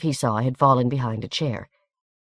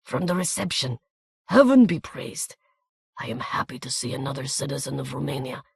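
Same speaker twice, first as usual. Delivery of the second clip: put-on soft accented voice; 3 dB quieter than usual. A narrator's reading. Little man greets fellow Romanian.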